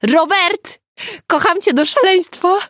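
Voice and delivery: very high, loud squeal of love; high-pitched ecstatic cry; elated shout. A narrator's reading. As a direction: yell it out, giddy and unguarded. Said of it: "krzyknęła Nina".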